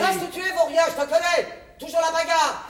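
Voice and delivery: loud, high scolding mother; angry scolding. Acted. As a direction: snap at the troublemaker son. Mère gronde son fils. (mère qui gronde fort)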